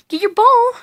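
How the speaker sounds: excited command to fetch; excited urging. Real, not acted. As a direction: pump the dog up. Urging dog louder.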